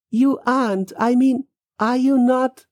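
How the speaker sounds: high, halting question; faltering disbelief. Acted. Man realises the girl may be dead, faltering. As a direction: break it up and pitch it high.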